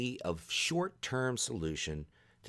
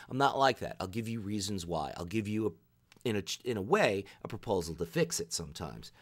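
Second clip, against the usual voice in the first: normal talking voice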